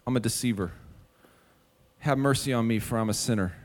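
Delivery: quiet confession. Acted. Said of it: "brings him to the point of saying".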